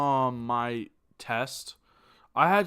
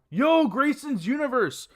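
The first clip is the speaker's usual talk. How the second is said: high, louder youtuber intro call; channel intro shout-out; 11 semitones higher, 5 dB louder than usual. Retold or imitated. Streamer recalling his intro, hyped intro voice. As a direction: belt it like an old channel opener.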